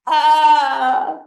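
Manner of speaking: high, loud anguished howl; howl of pain. A narrator's reading. Mother finds her child's finger and howls. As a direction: let the grief explode out of her. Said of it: "Junmai's howl exploded into the sky".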